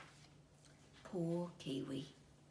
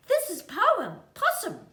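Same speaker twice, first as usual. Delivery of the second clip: high, loud motherly introduction; mother kiwi introduces the possum to her chick; 13 dB louder than usual. A narrator's reading. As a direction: introduce the neighbour brightly. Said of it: "said Mother Kiwi".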